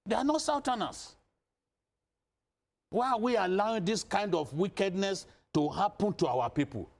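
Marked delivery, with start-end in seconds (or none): 0.1-5.3 higher pitched, impassioned appeal. Real, not acted. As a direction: plead passionately with the northern leaders. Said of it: "I've never had to speak like this".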